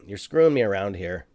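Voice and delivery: usual speaking voice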